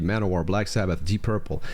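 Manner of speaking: ordinary talk, nothing marked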